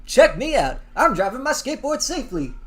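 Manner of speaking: lightly done cartoon mouse mascot voice; Chuck E. Cheese impression. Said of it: "Do a Chuck E. Cheese impression and say".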